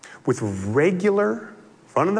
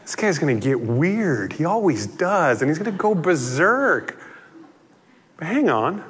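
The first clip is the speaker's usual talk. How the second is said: ordinary delivery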